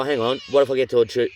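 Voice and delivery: ordinary delivery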